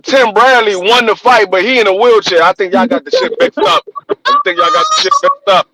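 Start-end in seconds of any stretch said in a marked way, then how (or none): 4.1-5.7 much higher, squeaky repeat; high mock voice. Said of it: "repeated in a high voice".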